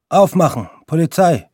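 loud, high barked command at the door; police shouting. Acted. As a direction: yell it like cops raiding the flat. (Polizei ruft laut an der Wohnungstür)

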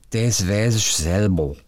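slow, slurred Saxon drawl; drunk Saxon accent. Retold or imitated. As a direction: grumble it like a sleepy Saxon drunk. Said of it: "Worauf er sagte". (verschlafenes, betrunkenes Sächsisch)

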